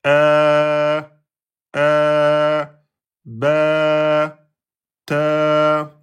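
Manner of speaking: "ba", "ta" held long and level; long a syllables. Shown as a demonstration. Teacher demonstrating long a after consonants. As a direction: sustain each syllable evenly. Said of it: "le temps de prononcer la voyelle fetha".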